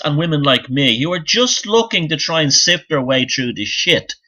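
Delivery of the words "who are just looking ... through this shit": ordinary delivery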